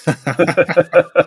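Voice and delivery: bright laughing burst; real laugh. Real, not acted. Telling a podcast host the punchline, a real laugh.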